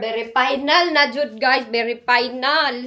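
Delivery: loud and firm, very final; emphatic announcement. Real, not acted. Vlogger announcing to viewers, loud and firm. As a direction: declare it settled to the guys. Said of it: "announcing to viewers".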